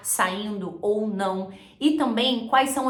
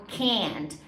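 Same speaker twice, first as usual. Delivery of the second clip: Texan can't, more nasal vowel; nasal Texas accent; 5 dB quieter than usual. Shown as a demonstration. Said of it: "bem mais nasal".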